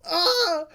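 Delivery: high pained cry; grief cry. Retold or imitated. Retelling Joseph's grief cry, high. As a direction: cry out in loss. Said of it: "it's like".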